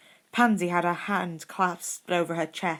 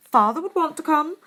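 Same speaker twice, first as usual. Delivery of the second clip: much higher and louder, eager; enthusiastic; 12 semitones higher, 7 dB louder than usual. A narrator's reading. Draco wanting to attend the wedding, eager. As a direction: burst out with eager delight. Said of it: "Draco said enthusiastically".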